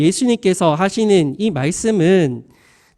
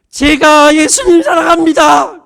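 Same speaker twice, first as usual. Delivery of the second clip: loud, high preacher's shout acting out Peter; 6 semitones higher, 6 dB louder than usual. Peter answering Jesus, shouting his love. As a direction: cry it out with full conviction. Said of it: "어, 외쳐요".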